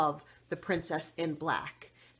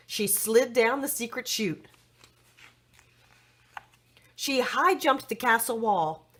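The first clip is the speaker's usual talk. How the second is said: usual speaking voice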